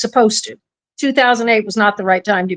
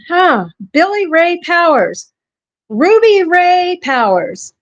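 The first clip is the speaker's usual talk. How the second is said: drawn-out, higher-pitched mock announcement of names; retold calling out names; 6 semitones higher, slower than usual. Woman alone at her computer, reading names aloud, mock calling out. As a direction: call the names out slowly, without real shouting. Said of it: "shouting out stripper names".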